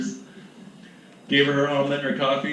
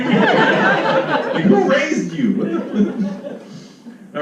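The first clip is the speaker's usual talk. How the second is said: laughing outrage; 13 semitones higher, 4 dB louder, much slower than usual. Retold or imitated. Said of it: "they're gonna be like".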